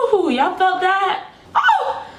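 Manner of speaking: high, laughing excitement; excited. Real, not acted. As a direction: squeal with delight. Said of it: "I am so excited".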